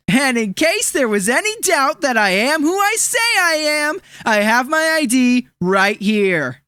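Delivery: ordinary talk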